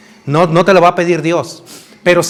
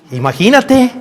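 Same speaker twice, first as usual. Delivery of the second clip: usual speaking voice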